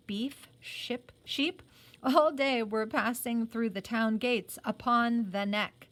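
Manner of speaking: ordinary delivery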